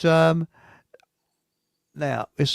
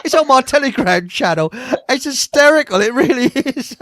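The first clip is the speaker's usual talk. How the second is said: louder, high-pitched, breaking into laughter; laughing amusement; 7 semitones higher, 5 dB louder than usual. Real, not acted. Host telling viewers about the hysterical haka video, laughing. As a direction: tell it through real laughter.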